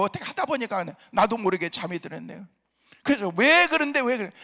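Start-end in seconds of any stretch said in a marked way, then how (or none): 3.4-4.3 high, loud exasperated yell; angry shout. Retold or imitated. Husband yelling at his wife how worried he was. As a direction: let it burst out of him.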